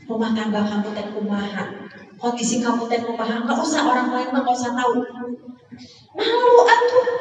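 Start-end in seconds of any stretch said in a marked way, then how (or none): 6.2-7.1 high, loud mock cry of shame; ashamed mother. Mother fretting over family shame, high mock cry. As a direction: squeal it like an embarrassed mother.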